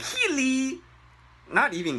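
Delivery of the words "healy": much higher squeaky rising Healy; mock squeaky Healy. Shown as a demonstration. Teacher mocking the foreign hello, squeaky Healy. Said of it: "it sounds like".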